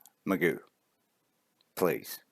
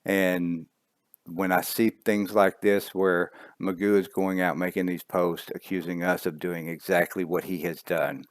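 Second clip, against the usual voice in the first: normal talking voice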